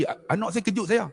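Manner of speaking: ordinary delivery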